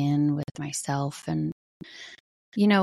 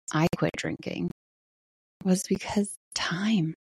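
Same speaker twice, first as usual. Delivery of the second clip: soft breathy whisper; 4 dB quieter than usual. Real, not acted. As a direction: let it drop to a whisper. Emotional admission about quitting drinking.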